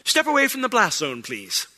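높고 장난스러운 신의 경고; high, playful divine warning; mock paraphrase of God.

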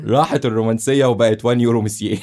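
laughing through the words. Real, not acted. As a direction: giggle while repeating it. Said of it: "laughing while repeating host".

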